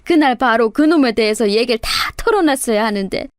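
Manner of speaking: put-on lamenting, weepy old mother; tearful apology. A narrator's reading. Mother-in-law in hospital confessing to daughter-in-law, tearful. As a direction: plead forgiveness in acted tears. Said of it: "하염없이 눈물을 흘리시면서".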